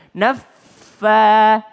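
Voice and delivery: NAFFA with a held F, then a short punched "fa"; doubled fa. Shown as a demonstration. Teacher drilling naffa, strongly doubled F. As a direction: pause on the F of NAFFA before releasing it.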